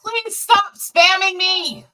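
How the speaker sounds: exasperated plea. Real, not acted. Pleading at chat spam.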